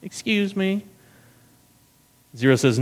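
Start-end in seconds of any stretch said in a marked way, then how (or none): 0.0-0.8 high, softer, haughty "Excuse me?"; indignant warden. Retold or imitated. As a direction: mimic her prissy signature line. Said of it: "That's kind of her line".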